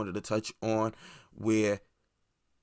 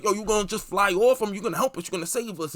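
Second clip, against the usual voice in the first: scared passengers; 8 semitones higher, faster than usual. Retold or imitated. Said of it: "people's scared".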